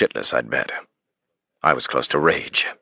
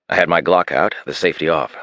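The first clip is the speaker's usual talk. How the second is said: plain reading voice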